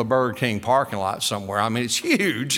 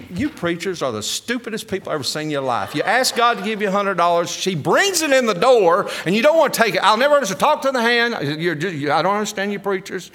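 exasperated scolding; 6 semitones higher than usual. Retold or imitated. Wife telling off her preacher husband over refused money, exasperated. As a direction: build to a high, indignant mock tirade.

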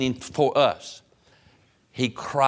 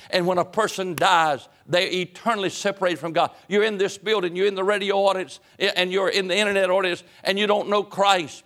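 high, insistent sermon voice; impassioned preaching; 7 semitones higher than usual. Acted. Preacher warning those in the building and radio audience, impassioned. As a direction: press the point on the listeners, pitch held high.

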